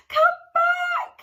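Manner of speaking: high, loud desperate shout. Acted. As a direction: yell after the cone as it flies away. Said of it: "shouted Cyril".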